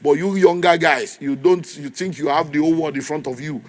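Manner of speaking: ordinary delivery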